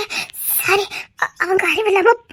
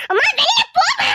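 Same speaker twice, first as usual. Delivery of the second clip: pleading shout; 7 semitones higher than usual. Acted. Child begging mother to leave.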